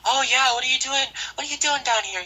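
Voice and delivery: high, mocking put-on voice; condescending guard impression. Security guard challenging a student, mockingly condescending and high. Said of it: "a condescending tone all the time".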